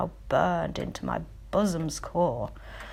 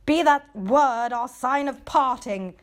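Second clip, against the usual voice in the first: higher, louder outburst at the bird; shrieked; 5 semitones higher, 6 dB louder than usual. Acted. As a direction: shriek it as you leap up. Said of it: "I shrieked".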